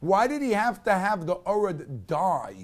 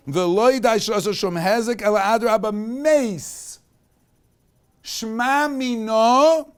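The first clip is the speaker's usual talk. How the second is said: louder, slow and emphatic Torah preaching; preaching at full force; 4 semitones higher, 6 dB louder than usual. Acted. Teacher rousing his class at the lesson's climax, louder fervent preaching. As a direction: drive the lesson home with fervour.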